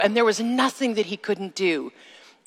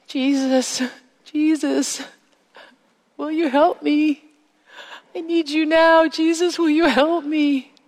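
desperate plea; 7 semitones higher than usual. Retold or imitated. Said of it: "didn't even recognize the sound of my own voice".

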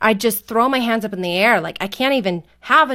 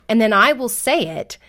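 ordinary talk

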